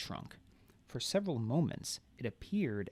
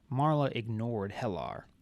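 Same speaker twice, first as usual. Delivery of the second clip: ordinary delivery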